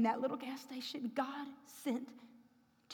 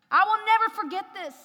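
preaching cry; 8 semitones higher, 10 dB louder than usual. Real, not acted. Preaching peak.

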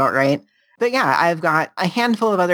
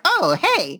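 much higher, perky "oh hey"; sudden burst of energy; 13 semitones higher than usual. Retold or imitated. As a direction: snap awake and greet brightly. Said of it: "it was like".

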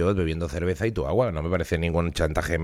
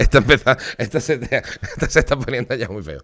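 laughing; 3 semitones higher, 3 dB louder than usual. Real, not acted. Giggling as chat derails.

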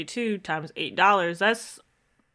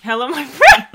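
hammed-up Jamaican scam-email greeting, louder; a higher, louder mock Jamaican scammer saying "Hello, my friend"; 5 semitones higher, 10 dB louder than usual. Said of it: "it's giving Jamaican scam prince".

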